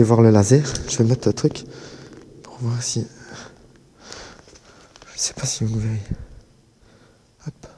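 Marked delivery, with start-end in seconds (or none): 2.4-7.7 whisper. Real, not acted. Quiet tunnel, checking the laser.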